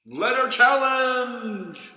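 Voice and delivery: game show call. Shown as a demonstration. Reader calls out the letter challenge. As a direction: announce it like a host. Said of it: "when you hear me say".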